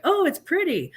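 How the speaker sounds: high, airy mock admiration; mock gushing. Mocking fans who like the dress, high gushing. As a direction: parody a fan cooing over it. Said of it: "who are like".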